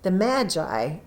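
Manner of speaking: ordinary delivery, nothing marked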